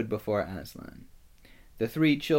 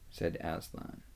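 ordinary delivery, nothing marked

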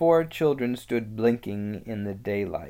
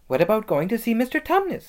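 put-on high, quick little girl's voice; keen, childlike excitement; eager suggestion; 11 semitones higher, 5 dB louder, faster than usual. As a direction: propose it fast and brightly, pitched up. Lucy eagerly suggesting a visit.

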